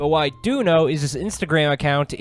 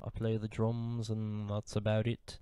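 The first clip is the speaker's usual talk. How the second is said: quiet, low Liverpool accent impression; 3 semitones lower, 9 dB quieter than usual. A Beatle introduces himself to fans, mock Liverpool accent. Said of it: "from your favourite Beatle, [name]".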